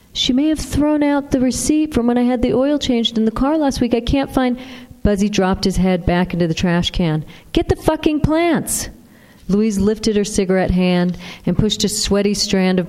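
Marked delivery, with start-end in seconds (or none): 0.1-4.6 a touch higher, absent-minded mumble; half-listening dad digging for a receipt; lightly voiced distracted husband. Husband rummaging in the trash, lightly voiced and distracted. 7.5-8.9 slow, much higher, biting demand; sudden anger. Acted. Mother snapping at her husband to get the plants, slow and angry. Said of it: "the suddenness of her mother's anger".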